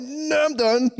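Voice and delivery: high laughing protest; giggling high voice. Retold or imitated. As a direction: laugh through it. Acted quote laughing.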